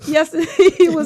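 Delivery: laughing through the words; can't stop laughing while telling it. Real, not acted. Mother laughing telling about her son.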